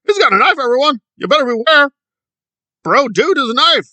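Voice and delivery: high, loud whiny cries of alarm; mock fake panic. Commentator mimicking the activist warning everyone, hammed-up panic. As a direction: overplay a frightened warning to the crowd. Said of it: "fake panic over nothing".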